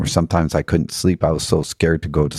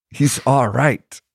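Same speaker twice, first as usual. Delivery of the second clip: put-on much higher, slow Irish accent of an old man; 8 semitones higher, slower than usual. Old Irish man passing on a spirit's message, in a high Irish accent. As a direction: lilt it like an old Irish fella, slowly. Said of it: "I'm not doing a very good Irish accent".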